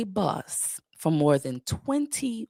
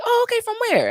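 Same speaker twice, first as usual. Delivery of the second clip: very high put-on questioning voice; imitating curious passengers; 4 dB louder, faster than usual. Bus passengers asking dad, imitated in high voice. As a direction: play a nosy stranger, pitched way up. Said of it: "they were like".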